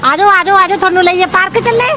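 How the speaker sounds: loud, high call to come along. Real, not acted. Mother calls the children to the park, loud and high.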